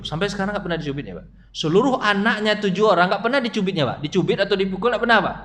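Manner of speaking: usual speaking voice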